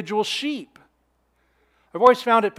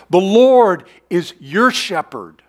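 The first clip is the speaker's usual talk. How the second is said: your leaned on, slow and louder; stress on your; 6 dB louder, slower than usual. Shown as a demonstration. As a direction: press the word your home, slowly. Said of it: "The possessive here is emphatic".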